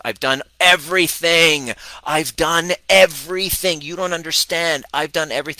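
high, loud insistent protest; imitating Laura. Coach imitates Laura's protest at her first session, high and exasperated. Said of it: "and she goes".

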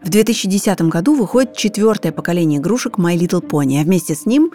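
normal talking voice

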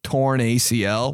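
ordinary talk, nothing marked

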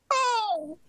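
high, mechanical robot voice. Acted. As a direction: speak like a glitching robot. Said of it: "Dan doing a robot voice".

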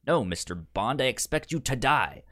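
usual speaking voice

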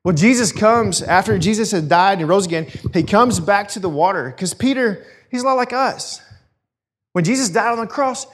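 normal talking voice

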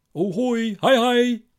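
an unseen man calling from outside the window; shrill ghostly hello that rises to a cry; eerie greeting. Acted.

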